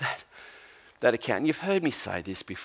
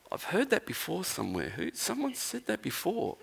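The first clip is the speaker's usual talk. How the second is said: high, soft puzzled wondering; half to himself, trying to place it; softly voiced disciple, lightly acted; puzzled musing; 7 semitones higher, 8 dB quieter than usual. Disciple muses over the stranger's words.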